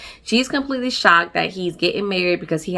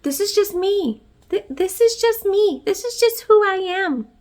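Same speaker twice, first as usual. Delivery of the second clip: much higher, prissy mock voice; mock Lydia; 11 semitones higher than usual. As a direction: mimic her insisting, put-on high. Lydia voiced defending herself.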